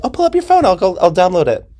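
very high, eager push; pushy eager. Retold or imitated. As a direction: pounce on them eagerly. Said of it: "I'm like".

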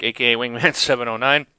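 ordinary delivery

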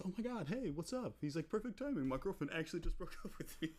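high, bright greeting with a laugh; high excited buddy. Retold or imitated. Bar back greeting his old buddy, high and excited. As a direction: greet like an overjoyed friend. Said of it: "he goes".

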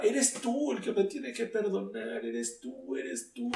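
high-pitched, mild mock yell, softening as it repeats; reenacted high plea; retold cry to God. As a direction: imitate crying out in protest without full volume. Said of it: "yo le gritaba".

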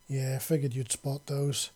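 usual speaking voice

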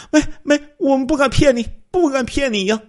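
high, loud stammering reassurance; hasty frightened plea. Acted. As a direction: rush to assure the robber. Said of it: "赶紧说". (慌忙高声辩解)

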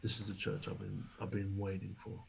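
hushed, teary retelling of the wife; retold crying. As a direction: say it quietly, as if moved to tears. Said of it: "and she's crying".

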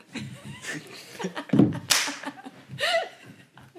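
high burst of laughing; loud laughter. Real, not acted. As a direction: crack up laughing. Laughing at own bad noise.

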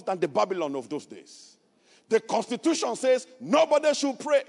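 loud, high preaching cry; full-force preaching. Acted. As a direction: preach it to the rafters. Preaching climax about Daniel.

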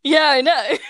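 ordinary talk, nothing marked

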